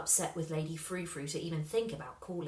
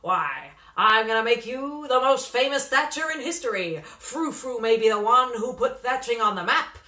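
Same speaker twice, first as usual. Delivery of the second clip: booming slick agent, much louder; loud brash manager; 2 semitones higher, 10 dB louder than usual. A narrator's reading. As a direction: sell the dream at full volume. Slingsby's loud sales pitch.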